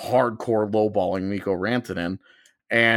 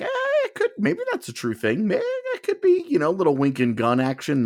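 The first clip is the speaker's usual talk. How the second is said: much higher, hedging mock voice; mock vague denial; 9 semitones higher than usual. Mocking a source who half-confirms a rumor, high and coy. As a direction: mimic a source's wishy-washy maybe.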